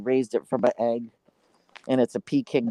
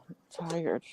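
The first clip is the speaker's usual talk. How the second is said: tired whisper; 3 semitones higher, 10 dB quieter than usual. Real, not acted. Sleepy complaint after yawning.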